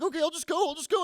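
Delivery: high-pitched, harried giving in; flustered compliance. Retold or imitated. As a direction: give in, squeaky and flustered. Flustered dad obeys wife.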